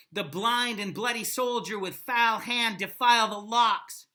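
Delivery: loud, declaiming reading; dramatic recitation. Acted. Teacher reads Henry's threat to Harfleur, loud and dramatic. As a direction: relish the brutal words.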